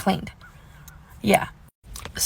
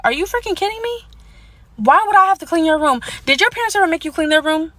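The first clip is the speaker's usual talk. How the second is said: high-pitched disbelief, acted out; can't-believe-this retort, pitched up; incredulous; 7 semitones higher than usual. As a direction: replay the outrage in a raised, incredulous pitch. Acting out disbelief at chore demand.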